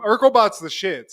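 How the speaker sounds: ordinary delivery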